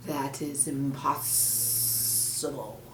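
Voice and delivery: menacing snake. Acted. Snake hints at the monkey's fate, low. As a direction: let the threat hang quietly.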